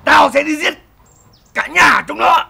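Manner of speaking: loud, enraged threat; loud mock-furious oath to kill the whole family; furious vow. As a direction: roar the vow to wipe them out. Husband vows to kill his brother.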